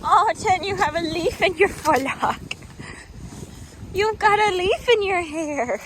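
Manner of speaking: high, loud sing-song coo; talking to a horse. Real, not acted.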